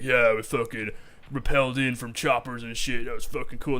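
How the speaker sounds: lightly voiced show-off soldier bragging; hint of a cocky squaddie boasting; mock bragging soldier. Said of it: "be like".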